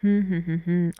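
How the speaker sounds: short closed-lip humming demo; wordless "hmm-hmm-hmm-hmm" hum. Shown as a demonstration. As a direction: hum syllables in a row, lips closed. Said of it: "listen and do humming".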